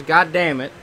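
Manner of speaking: ordinary talk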